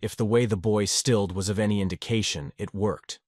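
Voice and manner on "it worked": plain reading voice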